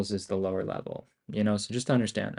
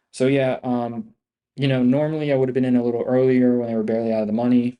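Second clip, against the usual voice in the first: loud fast rush; 3 semitones higher, 8 dB louder, faster than usual. Real, not acted. Sudden loud fast aside.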